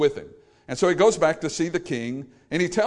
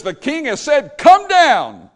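a soldier yelling an order uphill; commanding shout; 9 semitones higher, 10 dB louder, slower than usual. Retold or imitated. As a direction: bark it out, high and loud. Captain barking order at Elijah.

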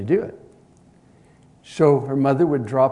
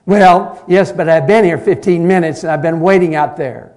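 loud, higher indignant protest; indignant complaint; 5 semitones higher, 14 dB louder than usual. Retold or imitated. Impatient teenager scolds the pastor for keeping her waiting, loud. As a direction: let him have it, offended.

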